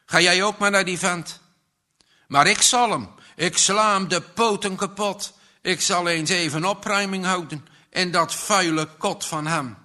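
a jealous husband raging at his wife; furious outburst. Acted. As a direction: lash out at the priest in a fury. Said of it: "En wild laaide zijn woede op".

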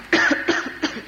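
put-on short dry-run old man's cough; acted cough. Narrator acting out the old man's coughing, acted cough. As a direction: cough like an ailing old man.

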